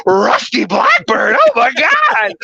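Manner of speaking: slow then shrieking high disbelief; squealing at the sight of a rusty blackbird; freaking out. Retold or imitated. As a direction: lose it over a rare bird. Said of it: "freaking out".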